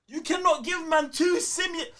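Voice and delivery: usual speaking voice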